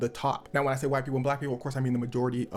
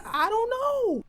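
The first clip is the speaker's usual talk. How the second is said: much higher, put-on clueless voice; mock clueless [name]; 4 dB louder, much slower than usual. [name] answering fans, high mock clueless voice. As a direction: squeak it out like a clueless kid.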